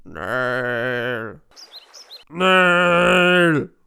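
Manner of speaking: loud call across the room. Acted. Old lord calls his servant Nel loudly. Calling out name.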